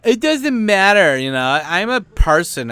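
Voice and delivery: high, loud, slow whiny protest; mock entitled whine. Mocking entitled foreigners, high and slow whiny. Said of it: "entitled little fucking dumbass".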